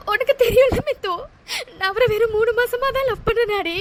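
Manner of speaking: sobbing out the words; heartbroken, crying it all out; tearful, despairing; weeping. Acted. Heartbroken woman weeps.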